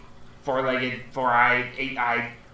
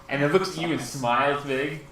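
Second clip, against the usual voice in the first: ordinary talk, nothing marked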